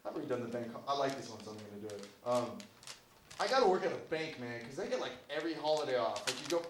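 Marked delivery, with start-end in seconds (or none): none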